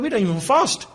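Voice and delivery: proud boast. Retold or imitated. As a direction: boast with arrogant pride. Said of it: "So proud, so arrogant".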